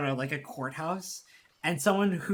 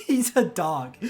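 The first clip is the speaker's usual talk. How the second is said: laughing; slower than usual. Real, not acted. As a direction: blurt it out through laughter. Still laughing after accent imitation.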